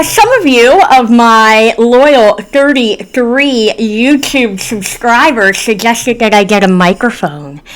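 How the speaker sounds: ordinary delivery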